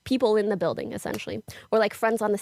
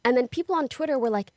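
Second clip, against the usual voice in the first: normal talking voice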